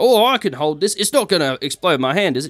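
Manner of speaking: high, worried villager voice; a bystander fretting over the firework; nervous local. Acted. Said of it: "he's like".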